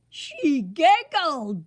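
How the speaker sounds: blubbering king. A narrator's reading. The king blubbering about Sunshine, loud slow sobbing. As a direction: sob the word out through tears. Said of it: "blubbered the king".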